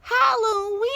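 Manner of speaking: high, loud gleeful witch cry; old witch crowing over her night; witch cackle. A narrator's reading. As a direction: cackle it with delight. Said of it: "she cackled".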